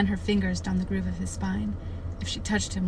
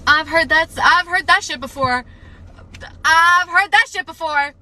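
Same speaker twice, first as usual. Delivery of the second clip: high, loud and insistent; raised-voice protest, repeated harder; loud indignant outburst; 9 semitones higher, 10 dB louder than usual. Real, not acted. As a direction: blurt it out at full volume, pitch way up. Outburst at a familiar book line.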